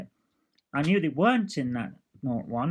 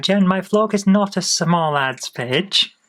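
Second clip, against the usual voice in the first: loud, slow, drawn-out telling-off; mock reprimand; 3 semitones higher, 7 dB louder, slower than usual. Real, not acted. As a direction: cut in loudly, spelling it out in protest. Cuts in on her sales plug.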